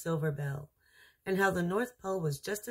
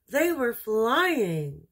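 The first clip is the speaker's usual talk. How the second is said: slow, louder, awed exclamation; thrilled reveal; 5 semitones higher, 6 dB louder, much slower than usual. A narrator's reading. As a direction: let the wonder burst out slowly. Narrator's climax, flight begins.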